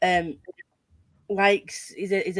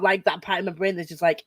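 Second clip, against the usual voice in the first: ordinary delivery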